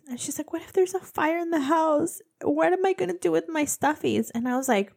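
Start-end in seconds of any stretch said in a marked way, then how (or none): 0.5-4.3 put-on higher fretting child voice; lightly voiced anxious child; imitated little daughter. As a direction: whine it like a worried kid. Mom imitates worried young daughter.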